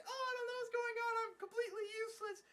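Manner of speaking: very high, hurried imitation of a panicking girl; mock helpless girl. Useless girl in the new robot, mock helplessness. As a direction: put on a high, hapless character voice.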